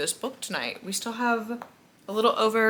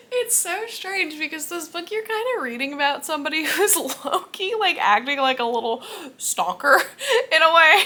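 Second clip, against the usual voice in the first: laughing through it, higher and louder; giggling breaks into the words; 9 semitones higher, 6 dB louder than usual. Real, not acted. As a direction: laugh as you try to explain. Laughing about stalker character.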